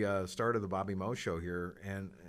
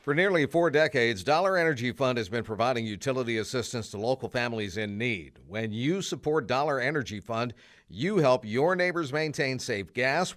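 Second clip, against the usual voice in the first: bright, upbeat sponsor read, a little louder; 2 semitones higher, 6 dB louder than usual. Acted. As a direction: sell the charity spot to local listeners. Host reads sponsor spot.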